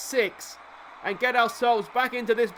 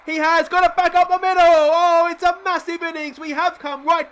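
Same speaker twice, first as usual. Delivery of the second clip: screaming, much higher and louder, voice cracking with joy; too excited; 9 semitones higher, 9 dB louder than usual. Real, not acted. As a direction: lose it completely as the hit goes up the middle. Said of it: "I'm getting too excited".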